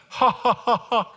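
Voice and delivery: high, loud mock guffaw; imitated laugh. Preacher imitating Vern's laugh at his heartbreak, mock guffaw. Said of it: "I'll never forget his laugh".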